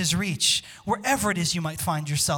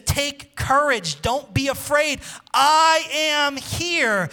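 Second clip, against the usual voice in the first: higher, louder, stretched slow proclamation; voicing God, proclaimed; 5 semitones higher, 4 dB louder, much slower than usual. God speaking to the afraid, a slow loud proclamation. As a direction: call it out like God's promise. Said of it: "He says".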